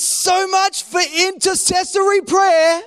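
hammed-up anguished tirade, easing down at the end; frustrated with God. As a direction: imitate an indignant man shouting his grievance to God. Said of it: "How easy it is for people to become frustrated with God".

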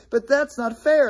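put-on high kid voice complaining; childish protest. Preacher voicing a child's complaint, high whine. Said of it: "somebody says".

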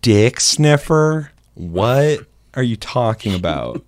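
slow, high-pitched disbelief; mock incredulous. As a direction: stretch each word in baffled disbelief. Acts out baffled reply to insult.

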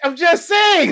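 high, loud insistence; shouting. Real, not acted. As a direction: yell it defensively. Shouted take.

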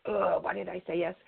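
mock dread at having agreed; inward regretful groan. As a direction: groan it to yourself, acting out reluctance. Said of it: "deep down inside".

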